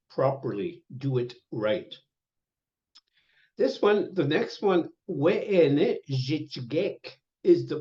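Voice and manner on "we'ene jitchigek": drawn out word by word; very slow "we'ene jitchigek"; slow teaching pronunciation. Shown as a demonstration. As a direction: model it slowly for the class. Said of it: "teaching plural form".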